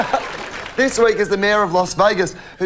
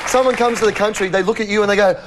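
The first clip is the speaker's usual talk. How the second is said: usual speaking voice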